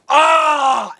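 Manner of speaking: a sudden, loud, high "Ah!" as the hero attacks; loud mock war cry; Yor's battle yell. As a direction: burst out with a yell like the hero charging in. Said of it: "Yor goes".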